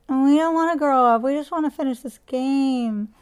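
loud, high wailing protest; wailing child. A narrator's reading. Bobby wailing at his mother about bedtime, loud. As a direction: wail it out like a kid refusing bed. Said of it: "Bobby wailed".

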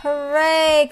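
high, loud cheer; cried out. A narrator's reading. Hornbill celebrates lion's escape, excited cry. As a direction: cheer in triumph. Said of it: "cried Hornbill".

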